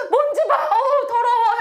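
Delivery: high-pitched grossed-out exclamation; disgusted outcry. Acted. Pastor acting out someone spotting dust, disgusted. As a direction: recoil in mock disgust.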